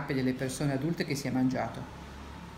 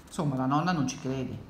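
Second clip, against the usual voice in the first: usual speaking voice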